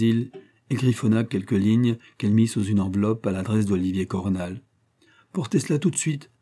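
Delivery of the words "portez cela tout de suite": commanding. A narrator's reading. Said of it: "commanda-t-elle".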